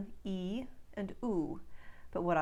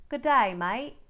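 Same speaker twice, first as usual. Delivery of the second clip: Good day, mate with "day" and "mate" said as dye and mite, louder; broad Australian greeting, louder; 4 semitones higher, 7 dB louder than usual. Shown as a demonstration. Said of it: "will become".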